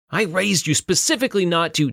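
high-pitched parental tirade; raging father. A narrator's reading. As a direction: scold your child in a rage. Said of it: "a rage that James".

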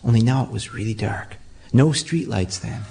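ordinary delivery, nothing marked

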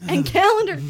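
sudden mock exclamation; exclaimed. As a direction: blurt the name out. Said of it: "Simon exclaimed suddenly".

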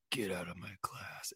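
whispered imitation of a stern teacher; hushed stern order. Teacher throwing a kid out of class, hushed and stern.

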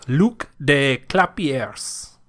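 put-on slow, careful French accent. Reading a quote's author aloud, French accent. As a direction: draw the name out in French. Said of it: "That was a good French accent".

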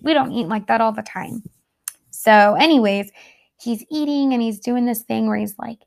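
ordinary talk

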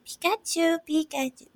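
high-pitched little cat-calling voice; talking to cat. Shown as a demonstration. Owner shows how she talks to her cat, high pet voice. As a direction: coo the name high up, as to a pet. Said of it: "that's how I talk to my cat".

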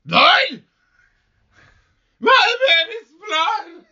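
high, slow wailing shout; horrified shout. Acted. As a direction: scream it out in horror. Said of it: "That one was probably not a good thing to shout".

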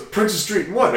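high-pitched, eager quizzing; excited retold question. Friend asking which corner he is on, excited. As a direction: press for the cross street, amazed.

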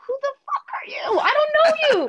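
high, incredulous squeak to a near whisper; who is this guy, baffled and high; mock disbelief. Singer recalling meeting the rapper, high incredulous squeak.

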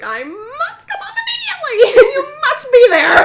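very high, loud, theatrical urgency; mock melodrama. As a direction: play the hammed-up damsel phoning home. Dramatic phone call to mother.

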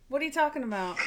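put-on Frankie accent. Host quotes Frankie to prove the accent, mimicked accent. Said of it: "That was an accent".

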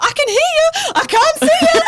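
high, loud frantic calling; panicked yelling. Retold or imitated. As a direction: shout across the water in panic. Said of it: "And I'm like".

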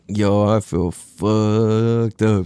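stoned drawl. Retold or imitated. Retelling being stoned.

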